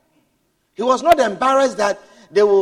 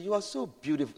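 soft, gentle praise; 13 dB quieter, faster than usual. Retold or imitated. Preacher soothing noisy children in the bank, softly. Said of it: "and I said".

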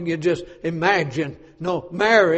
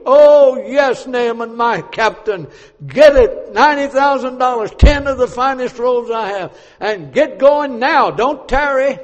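high, loud excited orders; king hurrying his captain on his way; eager urging; 6 semitones higher, 9 dB louder than usual. Acted. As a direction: push him out the door with excitement.

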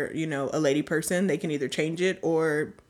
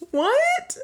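much higher, squeaky surprise; shocked squeak. Real, not acted. Host reading a puzzling comment, squeaky shocked what. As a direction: yelp it in disbelief.

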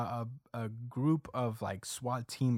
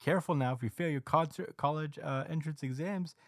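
higher-pitched mocking presenter voice; TV announcer; 6 semitones higher than usual. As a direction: put on a TV host needling a guest. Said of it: "the T V announcer is like".

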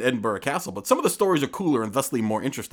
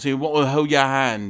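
mock Liverpool accent; 3 dB louder than usual. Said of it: "He wasn't that bad with my accent".

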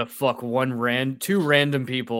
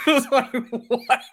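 high squealing laugh into a slow what; laughing at the silly line; laughing disbelief; 11 semitones higher than usual. Real, not acted. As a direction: crack up in disbelief. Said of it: "It's so great".

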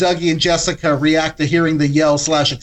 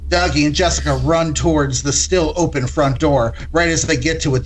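ordinary delivery

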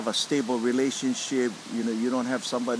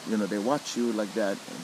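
usual speaking voice

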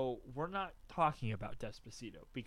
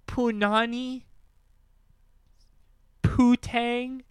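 incredulous; 8 semitones higher, 6 dB louder, slower than usual. Real, not acted. Mocking the silly names.

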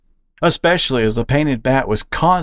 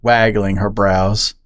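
plain reading voice

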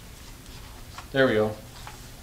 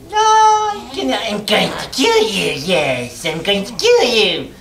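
high, loud shrieking goblin threat; bloodthirsty cackling goblin; 10 semitones higher, 9 dB louder than usual. Acted. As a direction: scream the threat like a crazed goblin. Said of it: "Gotta get goblin voice".

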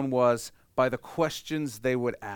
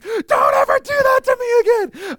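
shrieked furious scolding, much higher and louder; furious parent; 12 dB louder than usual. Pretend. Parent scolds found child, shrieking fury. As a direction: scream the scolding at the lost child. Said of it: "wanna hug them and smack them".

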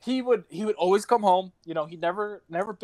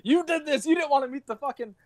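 higher-pitched mock scolding, half joking; mock angry scolding; 8 semitones higher than usual. Owner scolding her late dog for not meeting the family, mock angry. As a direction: scold the dead dog like he let you down on purpose. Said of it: "I was so mad at him".

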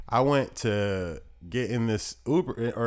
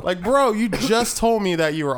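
indignant; 11 semitones higher, 5 dB louder than usual. Retold or imitated. Retold outrage at lying cab driver.

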